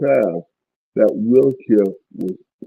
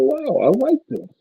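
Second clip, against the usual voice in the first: high, light and amazed; sudden delighted discovery; delighted wow; 12 semitones higher, 3 dB quieter than usual. Retold or imitated. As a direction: gasp of delight at feeling good again. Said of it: "I go".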